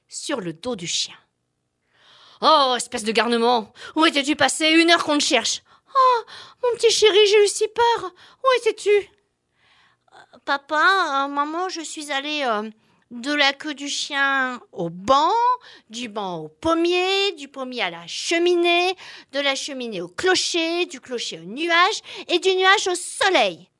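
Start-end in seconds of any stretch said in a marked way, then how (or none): 2.4-8.9 scolding then high and anxious; worried parent. Acted. 8.9-23.7 put-on a child explaining, hesitating; hesitant child. Baby flea explains.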